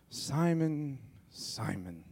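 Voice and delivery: exasperated name call, dropping on the second Simon; annoyed. Retold or imitated. Jesus to Simon, mildly annoyed tone. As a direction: sigh the name out, fed up. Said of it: "he says it, like, annoyed".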